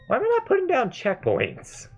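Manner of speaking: high-pitched exasperation at oneself; exasperated outburst. Real, not acted. Player scolds themself over missed checkpoints, high and exasperated. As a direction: cry out in self-reproach, pitch shooting up. Frustrated at own gameplay mistake.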